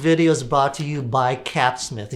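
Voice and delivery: ordinary delivery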